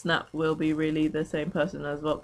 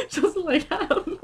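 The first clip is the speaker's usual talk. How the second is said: laughing; much slower than usual. Real, not acted. Giggling at her own joke.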